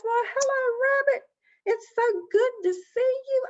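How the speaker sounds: very high, effusive greeting; gushing Possum. Acted. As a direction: greet a guest with showy delight.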